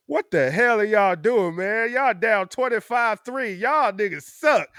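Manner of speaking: loud, slow, high taunting friend; a friend phoning to mock his losing team, loud taunt; mocking caller. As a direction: taunt him over the phone. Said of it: "They call me and be like".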